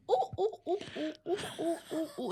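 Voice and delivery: soft, high string of monkey oohs; monkey hoots. Acted. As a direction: hoot softly like a monkey answering. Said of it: "And the monkey said".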